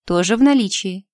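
usual speaking voice

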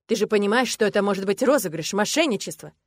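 the coach scolds Marat on live air; angry coach. Acted. Loud indignant coach.

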